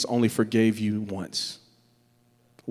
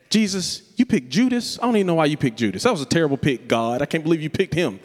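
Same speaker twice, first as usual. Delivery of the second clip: high, indignant complaint to Jesus; mock offended Peter; 3 semitones higher than usual. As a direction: play Peter as a petty, hurt disciple.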